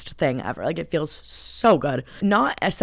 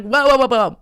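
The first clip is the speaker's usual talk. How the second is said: high, loud, panicked protest; re-enacted freak-out, raised and loud; 6 semitones higher, 7 dB louder than usual. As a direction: fire off alarmed whoas. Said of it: "freaking out".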